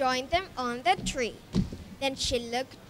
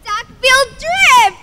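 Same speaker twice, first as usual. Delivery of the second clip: cheering; 11 semitones higher, 15 dB louder, slower than usual. Acted. Cheering the hero.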